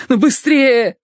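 high, frantic urging; urgent cry. A narrator's reading. Terence urges his friend to hurry, urgent cry. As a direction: hurry them along at a near shout.

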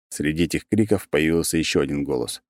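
usual speaking voice

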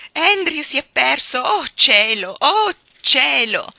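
loud, high distraught wailing; crying out. A narrator's reading. Miss Lark running round the garden after her lost dog, crying out. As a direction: call out in a high, loud panic. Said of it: "agitando le braccia e gridando".

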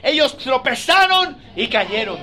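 preacher declaring the psalm, slow and loud; scripture reading at full force. Acted. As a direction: proclaim each verse slowly to the church. Reads psalm 27 aloud.

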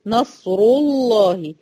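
نصر الله said slowly with a thick lam; heavy lam. Shown as a demonstration. Teacher demonstrating the heavy lam in recitation. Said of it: "هفخم هذه اللام".

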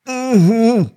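high mumbled uh-huh, mouth closed. Acted. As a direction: hum it through closed lips. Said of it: "промычал брат".

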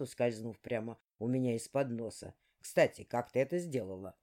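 a deep, unhurried demon lord; dark lord voice. Acted. Dark speaking.

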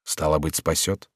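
soft, low inner thought; quiet reasoning to oneself; thinking to himself. A narrator's reading. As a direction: murmur it privately. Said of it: "подумал Реми".

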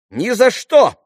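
very high, loud refusal; crying out. A narrator's reading. Lise forbids Alyosha to open the door, crying out. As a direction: shriek a girlish refusal. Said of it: "вскричала Лиз".

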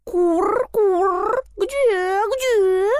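high cooing pigeon. A narrator's reading. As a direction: coo it high like a dove. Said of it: "ворковали голуби".

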